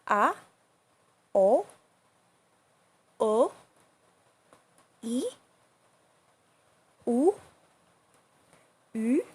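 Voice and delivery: A, o, o, e, u, u each short and climbing; A, o, o, e, u, u sweeping up from mid pitch; rising second tone. Shown as a demonstration. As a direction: lift each vowel A, o, o, e, u, u like a question. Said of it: "We use the rising tone".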